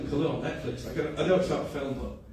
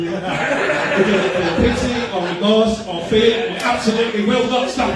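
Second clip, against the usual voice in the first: deadpan movie-trailer menace, louder and higher; Terminator quote; 6 semitones higher, 8 dB louder, slower than usual. Retold or imitated. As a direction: recite it like the Terminator speech, loud and building. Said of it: "that's the Terminator".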